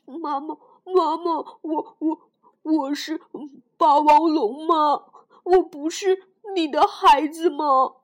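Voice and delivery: loud stammering sob-plea; a child begging mother through tears; tearful plea. Acted. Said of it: "梁太流着眼泪， 吸着鼻子说".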